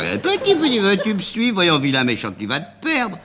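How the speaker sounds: voix aiguë qui cajole un petit animal; gronder un chien. Acted. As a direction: gronder tendrement un toutou. Parle au lierre comme à un chien. (le marchand parle au lierre comme à un petit chien)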